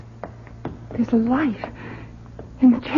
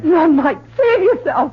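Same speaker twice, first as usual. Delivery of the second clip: frantic tearful warning; pleading scream for her son to run; desperate crying plea; 7 semitones higher, slower than usual. Acted. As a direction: cry out to him, much higher. Mother warns son to flee.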